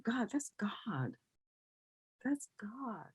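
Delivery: hushed, breathy whisper of awe, slowing down; retelling the moment, whispered in awe; awed whisper. Retold or imitated. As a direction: breathe it out as if stunned by the realization. Recalling moment of realizing God.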